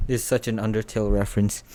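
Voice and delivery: plain reading voice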